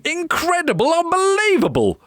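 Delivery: ordinary delivery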